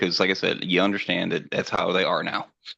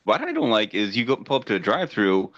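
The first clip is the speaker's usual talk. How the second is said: ordinary talk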